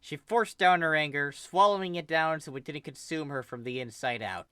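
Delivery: plain reading voice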